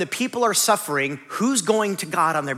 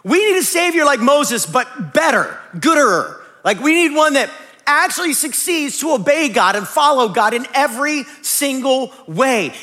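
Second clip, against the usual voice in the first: loud longing; 7 semitones higher, 6 dB louder than usual. Retold or imitated. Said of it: "you go".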